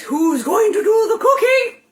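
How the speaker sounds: big, drawn-out, high announcer shout; show announcer. Pretend. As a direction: belt out the segment title like a game-show host. Calls out the show segment title.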